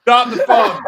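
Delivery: loud, laughing call to halt; big, loud comic interruption; excited outburst. Real, not acted. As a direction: shout it in amused disbelief. Mock outburst after the reading.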